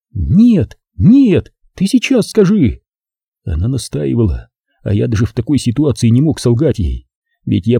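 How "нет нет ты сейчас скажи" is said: put-on high, rising girlish demand; insist, voice climbing high. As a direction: Zaza demands he say it now, high and pressing. Zaza demands an answer.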